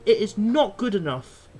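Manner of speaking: normal talking voice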